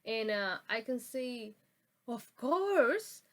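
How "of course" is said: loud, high, rising in surprise; taken aback, pitch swooping up loudly; surprised. Shown as a demonstration. Reacting to something strange or dangerous said, surprised.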